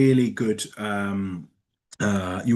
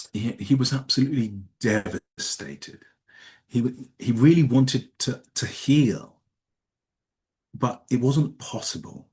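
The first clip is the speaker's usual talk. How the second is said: feeling the words. Shown as a demonstration. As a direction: let the sadness sit in each stretched vowel. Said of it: "I'm putting the emotion into those words and I'm elongating them".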